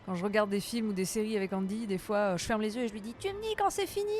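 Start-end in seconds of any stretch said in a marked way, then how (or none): 3.2-4.1 petite voix très aiguë imitant un enfant; voix d'enfant. Retold or imitated. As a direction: imite un enfant qui se cache les yeux, haut perché. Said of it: "Exactement comme les enfants". (imitation d'une petite voix d'enfant)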